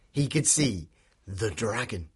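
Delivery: ordinary delivery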